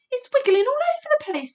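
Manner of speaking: put-on high loud girl's cry; alarmed cry. A narrator's reading. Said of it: "Sophie cried".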